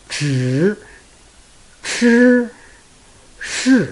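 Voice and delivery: zhi shi shi with the teeth closed; teeth together. Shown as a demonstration. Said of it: "The teeth are always together".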